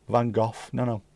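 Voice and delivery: ordinary delivery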